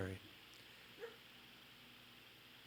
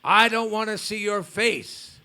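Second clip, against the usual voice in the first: higher, louder angry rebuke; angry yell; 5 semitones higher, 9 dB louder than usual. Retold or imitated. Enraged guru sending the disciple away, angry yell. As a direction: throw him out with your voice. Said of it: "he yelled at his disciple".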